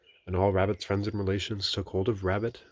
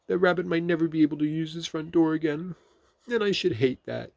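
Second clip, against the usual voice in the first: slightly sniffly; 10 semitones higher, 6 dB louder than usual. A narrator's reading. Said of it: "sniffing slightly".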